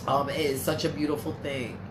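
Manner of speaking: choked up. Real, not acted. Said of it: "oh, God, I can't".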